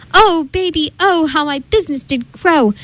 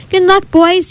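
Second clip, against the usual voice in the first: crying out farewell; 7 semitones higher than usual. Acted. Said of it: "he cried".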